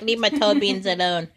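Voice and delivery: voicing the cat. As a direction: speak for the cat, annoyed and loud. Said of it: "He's like".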